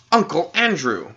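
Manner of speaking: put-on boy shouts the name as memory returns; shouted. A narrator's reading. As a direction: call the name out as it all comes back. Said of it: "he shouted out".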